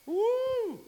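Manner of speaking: high, loud joyful whoop; joyful cry. Shown as a demonstration. Preacher shows the congregation loud praise, joyful whoop. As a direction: let out a sudden cry of joy.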